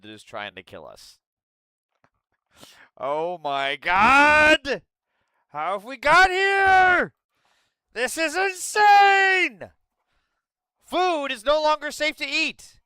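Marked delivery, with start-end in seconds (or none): 3.0-12.8 higher, louder, slow disbelieving yell; stretched-out cry of disbelief; outraged shout. Real, not acted. Outraged at food recall.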